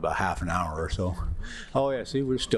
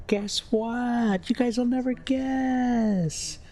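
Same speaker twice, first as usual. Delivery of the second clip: high, drawn-out teasing voice; playful teasing; much slower than usual. Real, not acted. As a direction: tease the viewers with a sing-song buildup. Teasing viewers about lost place.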